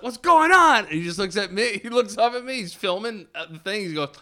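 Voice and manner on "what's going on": calling down. Retold or imitated. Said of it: "I was like".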